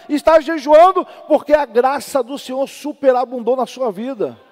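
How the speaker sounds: loud, high, driving sermon voice; fiery preaching. Acted. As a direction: preach at full force to the church. Preaching to congregation. (pregação inflamada, alta e aguda)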